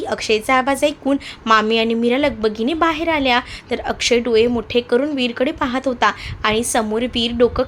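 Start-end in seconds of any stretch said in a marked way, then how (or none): none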